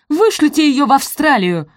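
high, loud squeal; shrieked demand. A narrator's reading. Araminta demanding Sophie be deported, shrieking. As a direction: screech the order in fury. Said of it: "взвизгнула Араминта".

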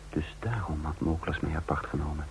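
soft whisper of a man realising he's trapped; hushed, dreading narration; fearful whisper. A narrator's reading. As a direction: whisper it as if afraid to be overheard.

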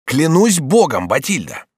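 high, loud, drawn-out vow; fervent oath. Acted. Bonifas swears to Batilda, fervent. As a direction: swear it with full passion.

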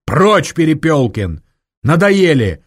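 angry dismissal; sharp shout. A narrator's reading. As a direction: shout him off. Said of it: "прикрикнул на рационалиста".

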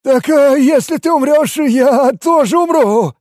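high, loud, frightened outburst; panicky Ken. A narrator's reading. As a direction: blurt it out in a high panic, dragging the end. Frightened Ken explains why he came. (паникующий высокий голос)

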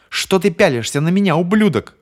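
high, loud screaming insult. Acted. Bonnie screams insults at James, high and loud. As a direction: yell the insult at him. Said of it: "продолжала вопить Бонни".

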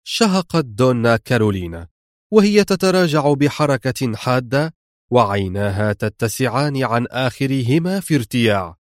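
ordinary delivery, nothing marked

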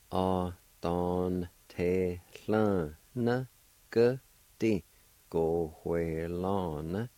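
"a don te lan ge di, go hue lan" drawn out slowly; slow demo. Shown as a demonstration. Teacher slowly joining all parts of the word for learners. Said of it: "And all together".

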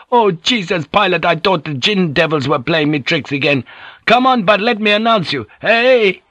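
high, overwrought, excited voice; tearful joy. A narrator's reading. Weeping drunk welcoming his lost pilot, tearful joy. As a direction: build from emotional relief to a high call. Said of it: "tears began to run down his cheeks".

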